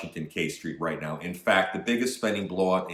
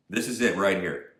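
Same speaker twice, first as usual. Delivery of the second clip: ordinary delivery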